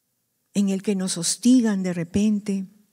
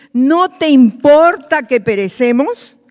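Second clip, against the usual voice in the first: raised, slow, drawn-out pleading question; pleading outcry; 4 semitones higher, 8 dB louder, slower than usual. Acted. Disciples crying to the Lord in the storm, loud and slow. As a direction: cry it out over the storm. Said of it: "Otras versiones dicen".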